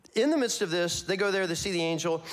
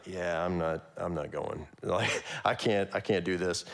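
dejected Peter; 7 semitones lower, 3 dB quieter than usual. Retold or imitated. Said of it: "maybe Peter would be like".

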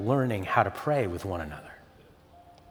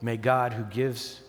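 quiet, softer pastoral benediction; soft blessing; 4 semitones lower, 6 dB quieter than usual. Acted. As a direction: bless the room gently and softly. Closing blessing from Scripture.

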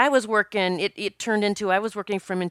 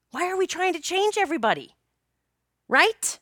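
impassioned; 10 semitones higher than usual. Real, not acted. Passionate outburst to viewers.

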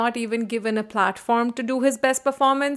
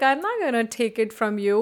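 normal talking voice

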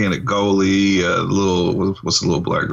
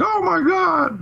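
high squealed oh my God; shocked gasp. Retold or imitated. Kimberly finding out Martha is Matthew, shocked high squeal. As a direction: shriek it in sudden shock.

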